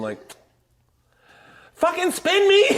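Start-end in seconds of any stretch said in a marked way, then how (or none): 1.7-2.6 very high, loud mock yell; high-pitched imitation of a tantrum; childish demand. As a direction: holler it like an impatient child. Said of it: "like a fucking child going".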